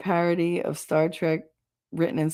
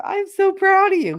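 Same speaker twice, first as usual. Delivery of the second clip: teasing pride; 5 dB louder than usual. Real, not acted. Teasing Thomas over dirty joke.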